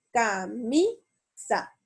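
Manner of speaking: "camisa" slowly syllable by syllable, MI stressed; ca-MI-sa, split apart, MI lifted. Shown as a demonstration. Teacher showing a llana word, "camisa" split with stress on MI. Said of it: "Aquí tengo entonces mi sílaba tónica acentuada".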